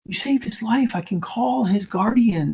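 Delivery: high, emotional voice, lightly acting tears; high-pitched and overcome, without real sobs; tearful, retold. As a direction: imitate a woman crying with relief. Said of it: "she's crying".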